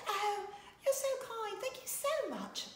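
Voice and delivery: high, slow, put-on British accent of Julie Andrews; a hammed-up British accent, gushing and slow; Julie Andrews impression. Julie Andrews thanking a radio host, in a bad British accent. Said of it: "horrible Julie Andrews British accent".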